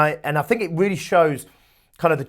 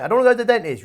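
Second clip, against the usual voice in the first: higher-pitched mock child protest; protesting kid; 7 semitones higher, much faster than usual. Kid refuses the dentist, high-pitched protest. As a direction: play a child digging heels in. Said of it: "the kid doesn't wanna go to the dentist".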